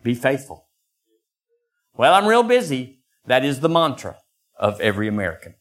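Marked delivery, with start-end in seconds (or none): 2.0-3.0 high, louder whiny excuse; squeaky put-upon excuse; mock busy excuse. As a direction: mimic the busy American. Mantra of every American.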